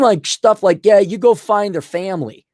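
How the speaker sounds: ordinary talk, nothing marked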